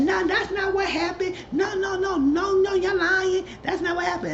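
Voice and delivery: very high, mock-protesting voice; insistent denial. Acts out Ashley's repeated denial.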